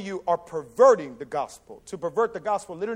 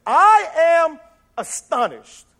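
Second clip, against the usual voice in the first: slow, loud, higher astonishment; Paul thunderstruck at the church; astonished; 8 semitones higher, 9 dB louder, much slower than usual. Retold or imitated. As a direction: drag out the shock, loud and slow. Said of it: "he switches the tone really quick".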